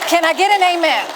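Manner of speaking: rousing call and response; rallying call. Real, not acted. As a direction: rouse the crowd like a preacher. Calling out to audience.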